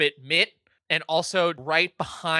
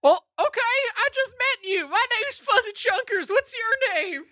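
high puppet voice. Acted. Puppet introduces himself.

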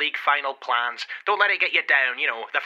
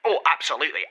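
ordinary talk

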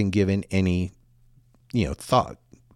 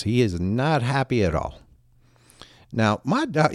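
ordinary delivery

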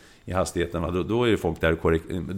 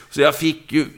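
ordinary delivery

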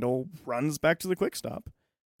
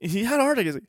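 shocked stoner; 9 semitones higher, 8 dB louder than usual. Retold or imitated. Stoner Jay shocked about Sergio's heart attack, much higher, louder, hammed-up alarm. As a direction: ham up a stoner's alarm, rising.

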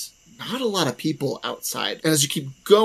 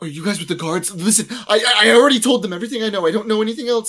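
higher, fast, panicky stammer; scared pleading; 7 semitones higher, 3 dB louder, much faster than usual. Acted. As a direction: beg them to believe him, rushing. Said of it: "he's rightfully scared".

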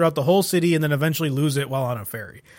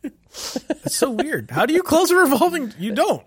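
high squeaky voice; 11 semitones higher than usual. Acted. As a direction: squeak it out comically. Joking about revolving doors.

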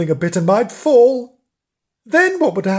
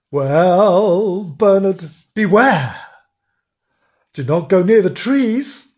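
loud, portentous warning with raised pitch; ominous warning; 3 semitones higher, 5 dB louder than usual. A narrator's reading. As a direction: warn him as if of grave danger. Nobbs solemnly warns the kitten.

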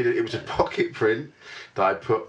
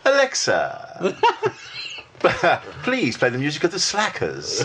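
Alexa voice; 2 semitones higher, slower than usual. Shown as a demonstration. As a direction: show off your posh talking-to-Alexa voice. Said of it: "have to do your Alexa voice".

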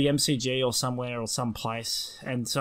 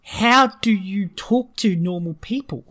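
slow, higher, laboured disbelief; mock exasperation; 5 semitones higher, 3 dB louder, slower than usual. Baffled listener to bogan stranger, in mock exasperation. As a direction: spell it out in exasperation.

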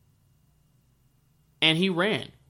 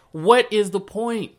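higher, louder and slow; exasperated; 8 semitones higher, 7 dB louder, slower than usual. Retold or imitated. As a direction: drag out the frustration. Exasperated self-quote.

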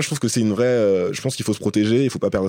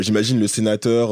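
usual speaking voice

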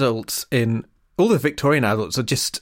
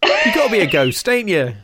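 mock Cockney; 6 semitones higher than usual. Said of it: "imitating Tom's quip".

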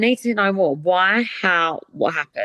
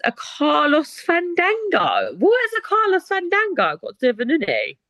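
baffled; 10 semitones higher than usual. Real, not acted. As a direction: savour the absurd name, baffled. Reading silly listener nickname.

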